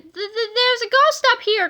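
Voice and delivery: high, loud stuttering alarm; frightened stammer. A narrator's reading. Wendy warns Kipper about the ghost, high stammering fright. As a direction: warn them, stammering with fright. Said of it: "cried Wendy Swan".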